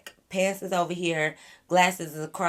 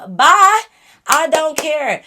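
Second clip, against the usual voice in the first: much higher, louder mock yell; loud high brush-off; 9 semitones higher, 12 dB louder, slower than usual. Guy in the car waving them off, high mock yell. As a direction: fling it out like an indignant dude.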